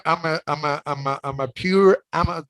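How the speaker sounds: ordinary delivery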